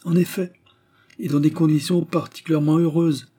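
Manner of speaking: high, cynical admission. A narrator's reading. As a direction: own up to it with cynical relish. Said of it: "avoua-t-il, cynique".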